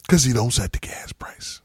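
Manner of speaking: hushed, breathy whisper of the point; conspiratorial whisper. Real, not acted. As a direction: whisper it like a secret everyone should know. Hushed aside repeating the point.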